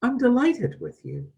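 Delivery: high, delighted voice of God; a parent beaming with pride; voice of God delighting. Retold or imitated. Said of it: "You hear a voice say".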